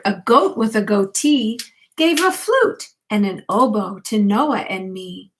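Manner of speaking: usual speaking voice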